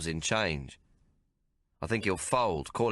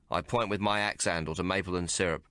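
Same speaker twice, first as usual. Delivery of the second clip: ordinary delivery, nothing marked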